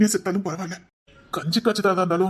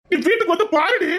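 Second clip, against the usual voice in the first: angry; 9 semitones higher, 5 dB louder than usual. Acted. Angry husband challenges wife.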